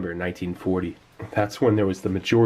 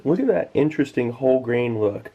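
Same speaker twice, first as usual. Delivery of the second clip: ordinary talk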